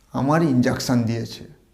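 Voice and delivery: ordinary talk, nothing marked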